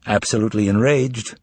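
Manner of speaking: usual speaking voice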